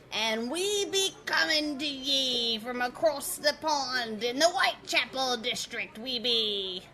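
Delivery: pirate-ish fake London accent, pushed up; fake Cockney accent. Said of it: "we've already got the bloody accents".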